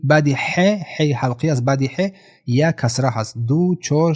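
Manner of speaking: normal talking voice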